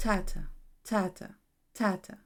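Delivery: "tata" as strong-weak pairs with gaps; evenly spaced "tata", each falling away; stress-timed beat. Shown as a demonstration. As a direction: tap out "tata" on a regular pulse, first syllable stressed. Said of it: "which means it goes".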